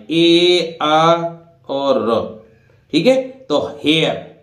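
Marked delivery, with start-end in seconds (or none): 0.1-2.2 ए, आ and र said as separate slow sounds. Shown as a demonstration. Teacher breaks हेयर into sounds for students. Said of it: "इस तरह से बोला है".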